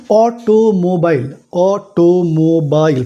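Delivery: "অটোমোবাইল" stretched slowly, অ-টো-মো-বা-ইল; syllable by syllable. Shown as a demonstration. Teacher counting five tongue movements, "অটোমোবাইল" slowly syllable by syllable. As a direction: sound out "অটোমোবাইল" one slow syllable at a time. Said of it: "five টাইমস জিভের গতি কিন্তু নড়াচড়া হচ্ছে".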